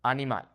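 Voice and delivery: Animal as ah-nee-MAHL; Spanish "Animal" with pure open vowels; Spanish pronunciation. Shown as a demonstration. Teacher proves the accent sounds better, Spanish Animal. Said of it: "my accent sounds way better".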